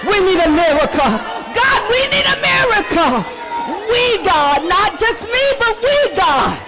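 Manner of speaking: high, full-throated preacher's shout; pleading holler at the top of the voice; hollering to heaven. Real, not acted. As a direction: cry out to God for everyone. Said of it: "begin to holler to heaven".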